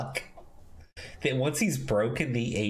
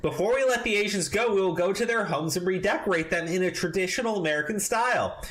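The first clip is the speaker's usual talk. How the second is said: high, raised reading voice; mock pompous reading; 8 semitones higher than usual. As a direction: read it like a smug manifesto. Reads article quotes in a put-on voice.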